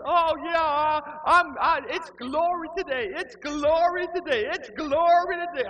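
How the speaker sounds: very high, loud squealing worshipper; hammed-up ecstasy. Kneeling lady answers are you okay, ecstatic high shriek. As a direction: shriek it out in mock religious rapture.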